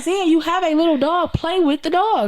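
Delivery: exasperated mom. Retold or imitated. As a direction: push your daughter to play with the dog. Scolding daughter about dog.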